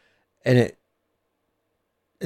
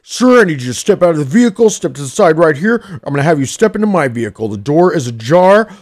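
fast, louder rattled-off official orders; officious tow driver; 5 semitones higher, 5 dB louder, faster than usual. Retold or imitated. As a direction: rattle off commands like a pushy driver. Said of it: "He's like".

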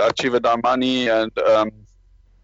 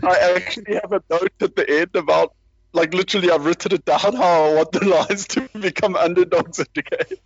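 real laughter bubbling through higher-pitched speech; laughing at oneself; 4 semitones higher than usual. Real, not acted. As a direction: talk through your own laughter, pitch riding up. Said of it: "sorry, I'm just laughing at, laughing at myself".

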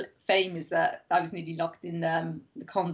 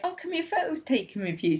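imitated eager tourists; 9 semitones higher than usual. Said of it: "were like".

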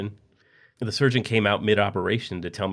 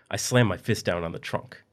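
usual speaking voice

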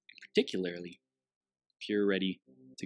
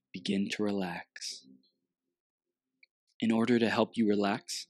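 guided meditation. Acted.

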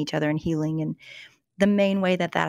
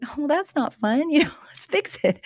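much higher, light and breezy, with a laugh; mock cheerful dismissal; 9 semitones higher than usual. Wife mimics brushing off husband's depression, high and laughing. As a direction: brush it off brightly, laughing at herself. She recalls her own reaction.

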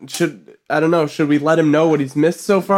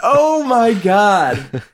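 laughing; 13 semitones higher, much slower than usual. Real, not acted. Cracking up at the clip.

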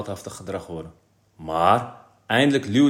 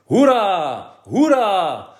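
high, loud, long-held hurrahs; yelling cheer; 9 semitones higher, 8 dB louder, much slower than usual. A narrator's reading. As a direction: cheer like a whole class. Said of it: "gilde de hele klas".

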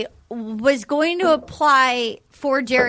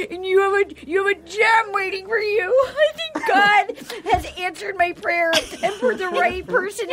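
higher, sobbing pleading mom voice; tearful pleading mom; 4 semitones higher than usual. Retold or imitated. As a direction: beg him through exaggerated tears. Retelling crying mom Barb.

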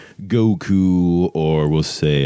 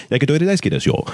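higher-pitched Japanese accent; 9 semitones higher, much faster than usual. Shown as a demonstration. Coach demonstrating Japanese accent for a character, Japanese accent. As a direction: speak Japanese in a raised, lively accent. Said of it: "Japanese could be even, like, the same thing".

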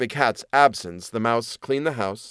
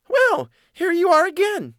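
very high mouse voice; welcoming mouse; 4 dB louder than usual. Acted. Mouse greeting the cat home at evening, high and welcoming. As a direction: pipe up in greeting like a little mouse.

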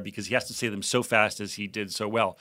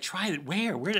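higher, softer puzzled question; mock bewilderment; 6 semitones higher, 4 dB quieter, slower than usual. Actor handed a new joke on paper, mildly baffled. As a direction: lightly act out being lost, voice rising.

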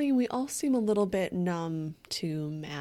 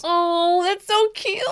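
gushing; 11 semitones higher, 7 dB louder, slower than usual. Real, not acted. Gushing over the bunny name.

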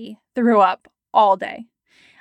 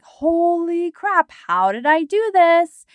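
mock panic; 7 semitones higher, slower than usual. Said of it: "And their body's like".

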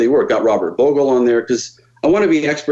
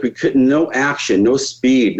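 ordinary delivery

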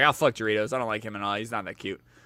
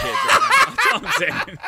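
laughing; 5 dB louder than usual. Real, not acted. Laughing at Chase.

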